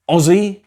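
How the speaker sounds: "Aussie" said as OZ-ee, high and loud on a rising tune; clear model word. Shown as a demonstration. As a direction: present "Aussie" with a bright rising OZ-ee. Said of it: "is a word that means an Australian".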